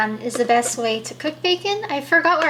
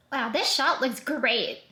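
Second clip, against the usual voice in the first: ordinary talk, nothing marked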